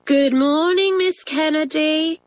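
high, drawn-out singsong schoolgirl greeting; class chorus. A narrator's reading. Whole form answers their teacher, chorused singsong. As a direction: chant it slowly like a whole class. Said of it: "chorused the form".

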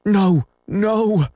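very high frightened plea; cowering, high-pitched refusal; crying out. A narrator's reading. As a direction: cry out high, begging off.

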